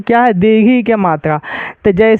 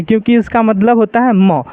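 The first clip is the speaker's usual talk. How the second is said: ordinary talk, nothing marked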